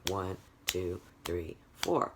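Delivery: low, even "One, two, three, four"; counting on the beat. Shown as a demonstration. Teacher showing quarter notes, evenly spaced count. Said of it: "You'd be making a sound".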